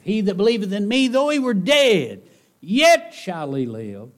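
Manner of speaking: high, loud, fast then slowing proclamation; proclaiming. Acted. Preacher quoting Jesus to congregation, loud proclaiming. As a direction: ring it out to the back row.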